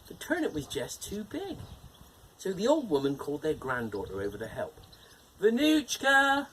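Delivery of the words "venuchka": high, slow, drawn-out shout. Acted. Old woman shouts for her granddaughter to come help, loud drawn-out call. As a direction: shout her name across the garden. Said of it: "she shouted".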